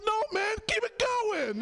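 high pleading no man; waving him on. Retold or imitated. To the polite Prius driver, high exasperated urging. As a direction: urge the stopped driver to keep going, high and pleading. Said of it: "I'm looking around like".

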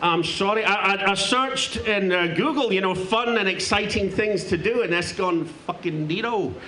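mock Glaswegian comic voice, played broad; a lightly held Scottish accent; put-on Scottish accent. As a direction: talk like a brash Scotsman fresh off the plane. Holds a put-on Scottish accent until admitting he's London-born.

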